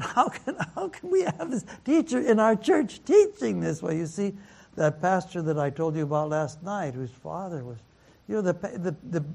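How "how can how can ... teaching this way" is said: high-pitched, half-laughing imitation of dismay; incredulous protest. Friend calls about the church's parenting teacher, incredulous protest. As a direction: act out an appalled friend, pitch way up.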